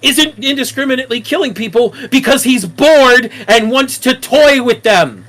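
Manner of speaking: loud, pissed-off ranting; heated, furious rant; angry rant. Real, not acted. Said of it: "Yes, I'm fucking pissed off at stupid people".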